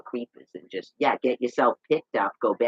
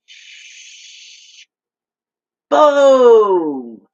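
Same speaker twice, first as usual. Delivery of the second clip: hushed shh then a loud high boom; whispered shh, then a shouted boom; shush then boom; 10 semitones higher, 12 dB louder than usual. Retold or imitated. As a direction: hush them, then explode. Mock explosion.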